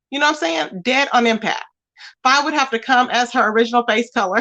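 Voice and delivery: usual speaking voice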